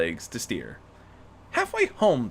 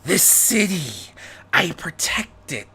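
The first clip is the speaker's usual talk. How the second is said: slow, strained attempt at a gravelly vigilante growl; Rarity forcing a growl to sound like Batman; 5 semitones higher, slower than usual. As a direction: growl it slowly like a caped avenger. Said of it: "she growled, doing her best to make her voice gravelly".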